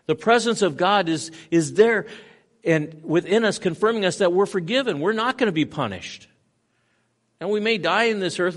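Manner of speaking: rising fervent pulpit delivery; full-force preaching. Acted. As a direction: preach it out to the whole room.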